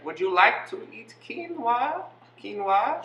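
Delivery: put-on high, slow, botched Australian accent; attempted Australian accent. Asked for Australian, offers food in high botched Australian accent. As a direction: stretch out a badly missed Australian accent.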